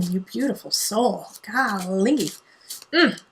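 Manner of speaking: ordinary talk, nothing marked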